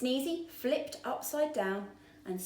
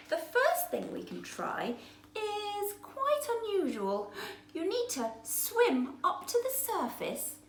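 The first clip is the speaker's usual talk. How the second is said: high, upbeat small fish; cheerful helper fish; chirpy little fish; 5 semitones higher than usual. Acted. Tiny explains plan.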